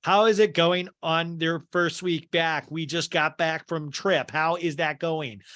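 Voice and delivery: slow, stilted recital; first-season newcomer. Retold or imitated. New cast member feeding a planted question, slow and stilted. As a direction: read it out like a coached beginner. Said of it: "somebody you can tell in their first season".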